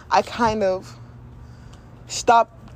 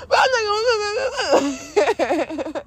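high, loud mock wail; hammed-up tearful refusal breaking into laughter; inner child tantrum; 13 semitones higher, 3 dB louder, slower than usual. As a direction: whine it like a stubborn brat. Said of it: "I was having this tantrum".

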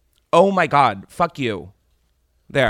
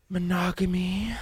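whispered punchline; hushed, teasing delivery; suggestive whisper; 8 semitones higher, 4 dB quieter than usual. Acted. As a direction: whisper it like a secret temptation.

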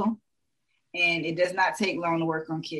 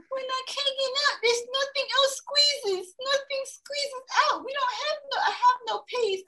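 put-on little boy voice. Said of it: "This little boy was like".

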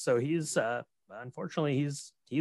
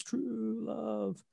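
high, soft, airless gasp; gasped words; 9 semitones higher, 7 dB quieter than usual. Retold or imitated. Dying man gasping to his rescuers, airless. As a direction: wheeze it out with no air. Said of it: "you know, it's".

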